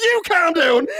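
high-pitched defiant echo; mock angry comeback. Suspect back at police, mock indignant retort. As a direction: imitate a suspect throwing the order back.

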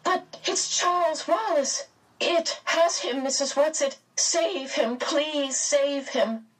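high, urgent pleading. A narrator's reading. Meg begging Mrs Whatsit to save Charles, pleading. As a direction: beg desperately in a high voice. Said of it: "flung out her arms pleadingly".

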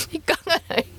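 high-pitched laughing through the words. Real, not acted. Streamer amused at not feeling any benefit, laughing. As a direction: crack up while saying it.

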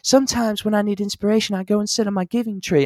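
soft high celebrity impression. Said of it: "[name]'s like telling [name]".

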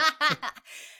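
a bright burst of laughter; high laugh. Real, not acted. Co-host catches the plot point and laughs out loud. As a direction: laugh at your own realization.

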